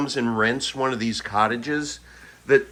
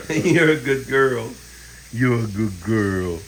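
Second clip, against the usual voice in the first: praising a dog; 4 semitones lower, slower than usual. Real, not acted.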